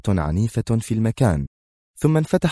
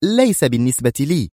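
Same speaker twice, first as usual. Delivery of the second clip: higher-pitched, strict retort; stern; 6 semitones higher than usual. A narrator's reading. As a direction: cut the objection off sternly. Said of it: "وهي تقول في صرامة". (بصرامة)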